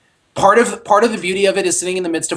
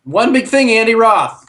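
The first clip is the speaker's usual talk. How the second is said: raised, louder segment announcement; segment announcer; 5 semitones higher, 5 dB louder, slower than usual. Pretend.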